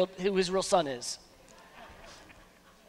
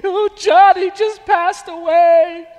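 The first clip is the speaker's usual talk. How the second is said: hammed-up tearful wail, higher and louder; mock sobbing wife; 9 semitones higher, 10 dB louder than usual. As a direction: imitate a wife weeping over a novel. Wife crying over novel.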